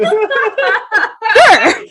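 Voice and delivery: very high, laughing it out; amused, squeaky yes through laughter; laughing "sure". Retold or imitated. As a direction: agree while cracking up. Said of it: "And I'm like".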